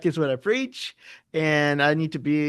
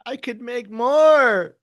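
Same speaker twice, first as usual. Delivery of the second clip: high, slow, drawn-out wail; mock whining; 8 semitones higher, 4 dB louder, much slower than usual. Trader protesting that gains are being missed, mock whining. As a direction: stretch it high in playful protest.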